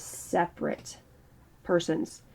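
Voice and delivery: ordinary delivery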